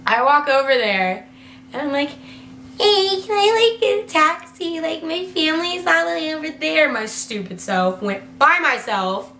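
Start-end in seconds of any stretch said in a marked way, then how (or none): 2.8-6.9 high, sing-song asking voice; high-pitched tentative ask; retold naive request. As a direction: imitate your younger self asking sweetly, pitched up. Retold timid request to taxi drivers.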